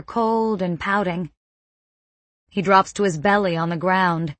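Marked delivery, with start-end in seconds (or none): none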